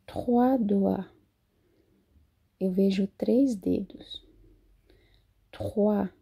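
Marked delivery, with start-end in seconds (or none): 2.6-4.3 whisper. Shown as a demonstration. Professora traduz a frase.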